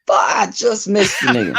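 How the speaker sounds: laughing exclamation. Retold or imitated. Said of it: "And I was like".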